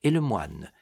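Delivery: plain reading voice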